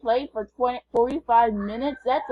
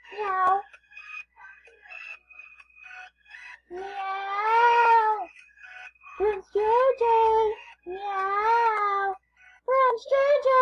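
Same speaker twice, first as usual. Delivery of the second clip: high squeaky kitty voice, meowing; high mewing cat voice calling a friend; 11 semitones higher, much slower than usual. Pretend. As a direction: pretend to be a little cat. Voicing the cat character Mittens.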